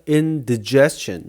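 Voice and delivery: indigestion with "dih", not "die", stress on jest; unstressed short i. Shown as a demonstration. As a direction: say indigestion as in-dih-JES-chun, slowly. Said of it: "die라고 잘 발음 안 하고요".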